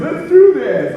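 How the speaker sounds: high, loud burst of enthusiasm; excited high-pitched call to action; eager yes. Retold or imitated. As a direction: jump in loudly, all gung-ho. Acting out eager man's reply.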